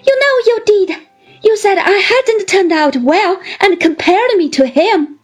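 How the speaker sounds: put-on high, aggrieved young wife; petulant accusation. A narrator's reading. Wife blaming her husband for comparing her, high petulant accusation. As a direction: accuse him in a high, hurt voice.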